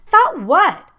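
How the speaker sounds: high, loud disbelieving demand; incredulous. A narrator's reading. Girl demanding what he thought, loud and incredulous. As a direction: throw it back at him in disbelief. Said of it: "I asked incredulously".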